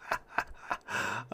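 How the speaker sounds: quiet airy laughter after a joke; laughing at the punchline, breathy; unvoiced breathy laugh. Real, not acted. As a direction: laugh silently under the breath.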